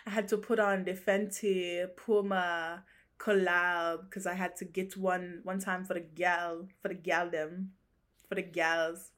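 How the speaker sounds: badly faked Jamaican accent; rough Jamaican accent. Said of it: "I don't know what accent I'm doing".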